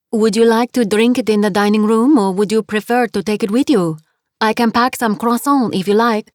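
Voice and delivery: put-on 2 cheerful landlady with a heavy French accent; 3 greet her warmly in thick French-accented English; 4 French guest-house owner, heavy French accent. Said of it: "her accent was heavy".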